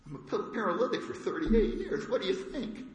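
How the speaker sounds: high, incredulous put-on voice with a laugh; sarcastic paralytic.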